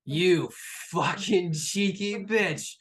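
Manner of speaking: slow, loud, punched-out exclamation; loud mock scolding. [name] to the surprise arrival, loud mock scolding. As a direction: relish each word, loudly.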